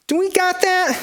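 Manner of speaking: high squeaky question; a high squeaky outlaw voice; squeaky sidekick. Acted. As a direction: pipe up like a little sidekick.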